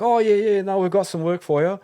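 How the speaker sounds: sing-song fake friendliness of an agency clerk; mock cheery agency voice. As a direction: put on a fake friendly brush-off. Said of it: "they'd be like".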